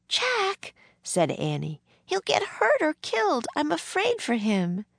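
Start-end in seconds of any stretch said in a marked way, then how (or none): 0.1-0.7 put-on very high girlish objection; alarmed young girl. A narrator's reading. Annie protests to Jack, high and alarmed. 2.0-4.8 put-on very high, anxious girl's voice; worried young girl. A narrator's reading. Annie afraid for John, high and worried.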